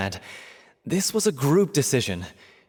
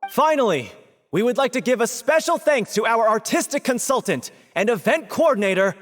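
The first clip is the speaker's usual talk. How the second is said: higher, projected curtain-call announcement; stage announcement; 9 semitones higher than usual. Acted. Host thanking the consultant before the audience, projected announcement. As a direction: call it out proudly to the whole theater.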